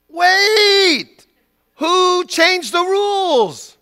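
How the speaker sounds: high, loud, drawn-out mock protest; outraged child's wail. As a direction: wail it like a thwarted toddler. Child protesting at changed rules.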